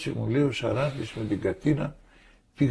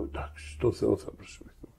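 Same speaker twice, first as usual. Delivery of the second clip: hushed retold agreement; 3 semitones lower, 5 dB quieter than usual.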